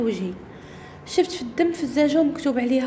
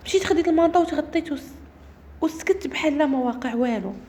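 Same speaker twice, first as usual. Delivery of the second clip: ordinary delivery, nothing marked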